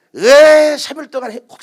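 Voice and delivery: loud, slow, high mock whine; hammed-up child complaining, drawn out; whiny complaint. Son whining at his father after the seminar, loud and high.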